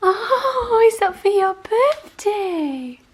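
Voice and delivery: high and loud, slow and thrilled; excited. Real, not acted. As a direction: gush over the surprise. Dog finds present.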